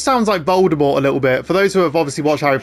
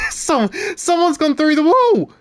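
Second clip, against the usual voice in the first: very high, squealing laugh-talk; laughing disbelief; 10 semitones higher than usual. Real, not acted. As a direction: crack up as you get the words out. Laughing at inmate blown through wall.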